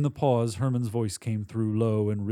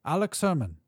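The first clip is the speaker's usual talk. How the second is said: put-on high, accented exterminator voice; high-voiced little Central European man; high-pitched foreign man; 6 semitones higher than usual. A narrator's reading. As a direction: introduce yourself in a high German or Polish accent. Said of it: "His voice was high-pitched".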